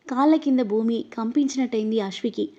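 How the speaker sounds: usual speaking voice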